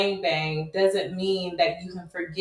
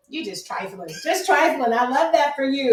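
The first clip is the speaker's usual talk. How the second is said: mom's voice; 6 semitones higher than usual. Retold or imitated. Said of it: "my mama be like".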